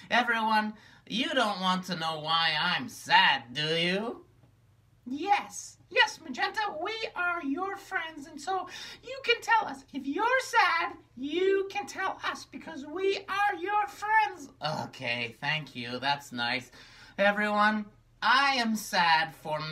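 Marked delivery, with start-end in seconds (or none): 5.1-14.5 very high, gentle puppet reassurance; caring high puppet. Acted. Charcoal reassures sad Magenta, very high and kind. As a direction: comfort her as a sweet little puppet.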